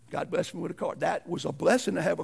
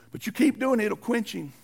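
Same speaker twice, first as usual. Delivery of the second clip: usual speaking voice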